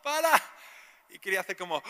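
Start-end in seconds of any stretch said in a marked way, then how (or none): 0.0-0.3 high-pitched mock shouts of protest; acted-out pained yells; imitated screaming. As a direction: cry out like a patient begging it to stop. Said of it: "Y la chica gritando".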